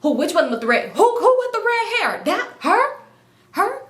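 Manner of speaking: high, loud, indiscreet questioning; comically obvious. Acted. Woman told to be discreet, loudly asking who. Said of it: "Look, don't make it obvious".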